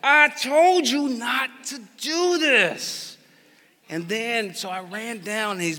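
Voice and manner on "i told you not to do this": high, slow, drawn-out scolding; exasperated parent. Retold or imitated. Father scolding his hurt son, exasperated. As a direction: stretch it out in frustration. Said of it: "you're caught between".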